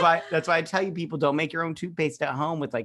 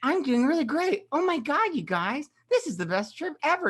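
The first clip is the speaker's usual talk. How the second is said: much higher, chirpy mock child voice; overly happy kid; 12 semitones higher, 4 dB louder than usual. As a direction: gush like a cheery kid with no idea of danger. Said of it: "He was so happy".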